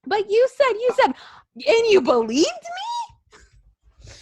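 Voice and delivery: very high mock disbelief; hammed-up affront, stretched out at the end; incredulous wife. Wife to husband who believed her, mock incredulous.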